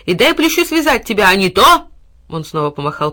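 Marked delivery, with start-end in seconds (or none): 0.0-1.9 loud threatening order; threatening boy. A narrator's reading. Boy threatening the dog. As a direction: threaten with the stick.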